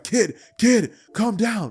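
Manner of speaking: high, loud, urgent calls; alarmed pleading. Acted. Old trainer dodging for his life from his pupil, alarmed pleading. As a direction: shout to rein someone in, alarmed.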